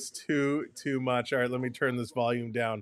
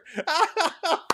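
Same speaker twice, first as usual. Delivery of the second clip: high squealing laugh; 13 semitones higher than usual. Real, not acted. Laughing at chat.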